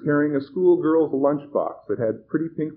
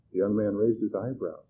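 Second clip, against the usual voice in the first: usual speaking voice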